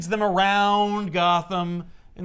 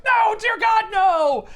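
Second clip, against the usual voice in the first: much higher and louder, a desperate scream; anguished shout; 7 dB louder, much slower than usual. Retold or imitated. Retelling Selina watching Maggie fall, anguished shout. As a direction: cry out as the sister drops.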